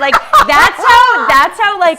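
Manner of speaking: high, loud laughing shout. Real, not acted. Laughing at his own naivety, high and loud. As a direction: burst out laughing at yourself.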